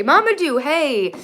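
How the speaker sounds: high, loud happy hello; bright burst of greeting to a chat friend; excited greeting. Real, not acted. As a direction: call out a name with delight.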